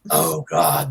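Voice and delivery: low groaned horror, hammed-up; mock dismay. Fan seeing his bad photo with the actress, low mock groan. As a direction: groan it deep, in mock despair.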